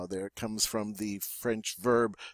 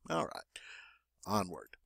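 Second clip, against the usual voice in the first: whisper; 5 semitones lower, 14 dB quieter, faster than usual. Real, not acted. Teacher moving on, whispered.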